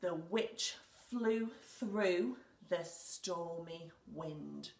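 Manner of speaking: slow, word by word, for writing; slow dictation. Shown as a demonstration. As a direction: spell it out slowly as you write. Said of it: "I'm going to write".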